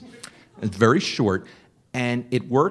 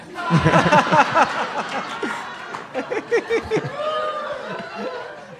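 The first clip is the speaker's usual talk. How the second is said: big laugh. Real, not acted. Laughing hard at his own [name] punchline. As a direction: crack up at the punchline.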